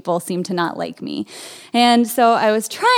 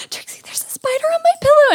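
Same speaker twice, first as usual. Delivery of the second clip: much higher, frightened call for help; alarmed; 4 dB louder, faster than usual. Retold or imitated. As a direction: cry out to the counselor in alarm. Kid alarmed by spider.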